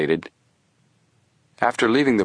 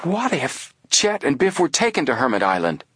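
excited; 8 semitones higher than usual. A narrator's reading. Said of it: "said excitedly".

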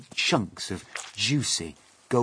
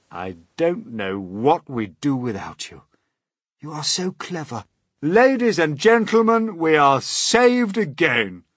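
loud, effusive praise building high; delighted Old Green Grasshopper; 9 semitones higher, 11 dB louder than usual. Acted. Grasshopper praising James to everyone, delighted and rising. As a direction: build to a grand announcement.

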